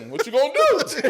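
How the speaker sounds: high-pitched mock dare, laugh underneath; Harlem Nights-style challenge. Actress looking at him on the floor, mock challenging. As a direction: imitate a needling onlooker, high and amused. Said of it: "like the Harlem Nights, like this".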